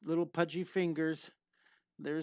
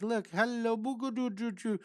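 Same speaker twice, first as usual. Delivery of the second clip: put-on higher cooing baby talk; 6 semitones higher than usual. Teacher talking to the drawn baby Jack Jack, cooing. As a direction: coo at the baby drawing.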